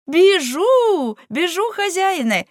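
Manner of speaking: high, loud, eager reply; eager call. A narrator's reading. Servant rushes off on the master's order, eager call. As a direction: call out high as you dash off.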